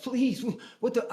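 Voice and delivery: high, frantic begging; retold panicked plea. Pleading while being attacked.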